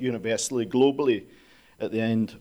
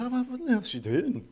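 puzzled; 11 semitones higher than usual. Retold or imitated. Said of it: "And I was like".

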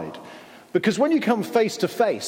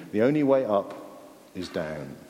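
usual speaking voice

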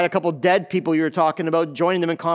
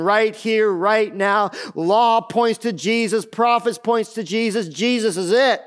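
slow, higher-pitched proclamation; emphatic preaching; 3 semitones higher than usual. Real, not acted. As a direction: preach it with building insistence, word by word. Preacher proclaims at full force.

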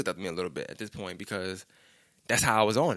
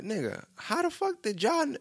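astonished demand; 12 semitones higher than usual. Retold or imitated. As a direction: act out high-pitched disbelief, cut off midway. Retold astonishment, asking how they knew.

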